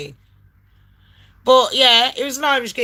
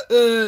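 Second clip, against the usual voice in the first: high, sharp gasp back to life; mock reviving gasp; 11 semitones higher than usual. Acting out self-revival after heart attack, hammed-up high gasp. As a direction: gasp as if jolted awake.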